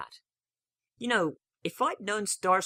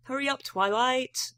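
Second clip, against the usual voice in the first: put-on high, slow young dragon call; Spike calling; 11 semitones higher, 3 dB louder, slower than usual. A narrator's reading. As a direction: call her along like an impatient kid. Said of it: "says Spike".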